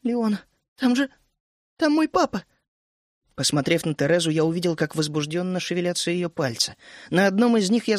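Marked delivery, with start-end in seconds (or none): 0.0-2.4 put-on high, halting, frightened girl; falter, then rise in alarm; shocked realisation. A narrator's reading. Вишня telling Леон her father is there, shocked realisation. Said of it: "шевельнула побелевшими губами".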